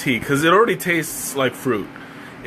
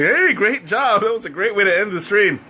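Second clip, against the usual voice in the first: mock cheerful viewer; 7 semitones higher than usual. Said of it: "you're like".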